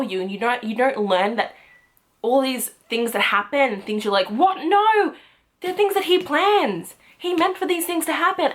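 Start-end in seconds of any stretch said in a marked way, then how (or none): 4.3-8.5 high-pitched, astonished reader reaction; shocked disbelief. Acted. Reader reacting to Kaz's hidden plans, shocked disbelief. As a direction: react as if the twist just blindsided you. Acting out reaction to plot twists.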